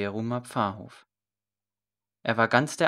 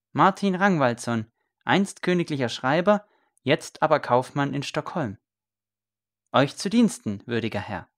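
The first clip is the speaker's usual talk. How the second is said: higher, louder, ceremonious; proud self-introduction; 5 semitones higher, 5 dB louder than usual. A narrator's reading. Scribe introducing himself to the priest, proud and formal. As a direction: announce yourself with a flourish.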